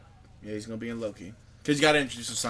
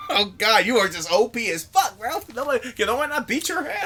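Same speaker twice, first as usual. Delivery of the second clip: exasperated; 8 semitones higher, 4 dB louder than usual. Acted. Mock exasperation at overpowered villain.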